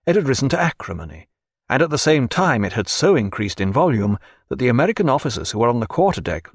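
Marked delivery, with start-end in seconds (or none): none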